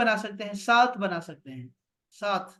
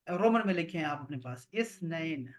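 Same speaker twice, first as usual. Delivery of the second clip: ordinary talk, nothing marked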